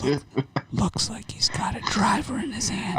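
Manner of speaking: whispered golf commentary; hushed play-by-play whisper; golf announcer whisper. Pretend. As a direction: whisper it as if beside the tee. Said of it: "Why are we whispering?".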